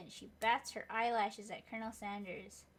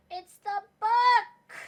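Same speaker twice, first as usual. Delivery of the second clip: gasp, then a loud slow high cry of discovery; shocked gasp; 11 semitones higher, 18 dB louder, much slower than usual. Acted. Player character spotting the hidden book, shocked gasp and shout.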